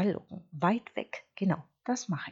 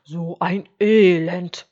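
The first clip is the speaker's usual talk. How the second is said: drawn-out, louder grumble; grumbling; 13 dB louder, slower than usual. A narrator's reading. Hungry wolf complaining about an empty house, grumbling. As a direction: grumble it out loudly and slowly. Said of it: "murrte Wolf".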